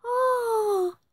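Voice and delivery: a high, long wailing cry; witch's wail. A narrator's reading. Witch wailing as the wind steals her hat. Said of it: "how the witch wailed".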